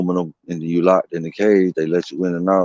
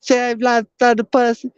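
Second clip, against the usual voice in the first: much higher put-on voice, the corrected take; imitating inmate's name. Teller's corrected take of the inmate introducing himself, high voice. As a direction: nail how the guy said his name. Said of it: "that's how he said it right there".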